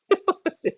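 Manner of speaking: sudden high-pitched laughing squeal. Real, not acted. Laughs at fair guy remark.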